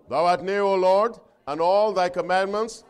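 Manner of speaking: ringing pulpit delivery; forceful preaching. Acted. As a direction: proclaim each quality of the law with weight. Leads congregation reading the verse.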